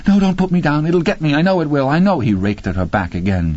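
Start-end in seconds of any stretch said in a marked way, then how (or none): 0.1-2.2 high, frantic woman's voice; acted fearful protest, pitched well up; terrified pleading. A narrator's reading. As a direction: beg in rising panic. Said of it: "She screamed in anger and pain and fear".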